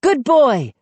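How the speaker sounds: loud, high praise; crying out. A narrator's reading. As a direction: cheer the dog on. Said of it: "I cried".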